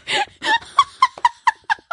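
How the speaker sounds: high, loud burst of laughing that cracks; losing it completely, squealing laugh; helpless laughter. Real, not acted. Giggling fit over the monk mix-up.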